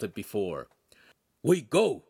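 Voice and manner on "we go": louder, higher barked order; dog bark. Acted. Dogface ordering departure, barked. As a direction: snap it like a bark. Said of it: "Dogface barked".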